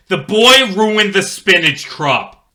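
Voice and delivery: high, loud, slow shout; accusing shout. Acted. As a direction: point and yell at the boy. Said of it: "the gardener shouts".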